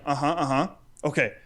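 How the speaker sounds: usual speaking voice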